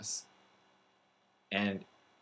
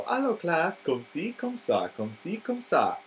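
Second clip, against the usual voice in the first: high, sing-song French teacher voice, slowing on the last comme ci comme ça; animated French teacher; 9 semitones higher, slower than usual. Retold or imitated. Teacher greets the class arms outstretched, high sing-song French voice. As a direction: ham up the giant-starfish teacher's Allô, much higher. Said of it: "and she'd go".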